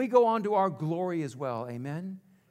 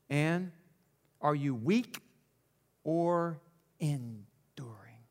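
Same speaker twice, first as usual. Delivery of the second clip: whispered question; 7 semitones lower, 5 dB quieter, slower than usual. Acted. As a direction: drop to a slow whisper on "or enduring". Dramatic question, ending whispered.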